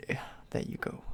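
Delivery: soft unvoiced whisper; quiet whispered shrug; whispered aside. Real, not acted. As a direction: whisper it under the breath. Aside after banning a viewer.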